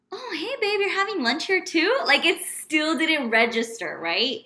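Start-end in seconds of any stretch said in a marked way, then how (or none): 0.1-2.0 higher, breezy oblivious hello; clueless casual greeting. Retold or imitated. Greeting her boyfriend, higher and breezily oblivious. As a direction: sound totally unsuspecting. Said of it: "And I was like".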